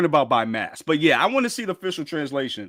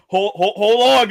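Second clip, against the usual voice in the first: usual speaking voice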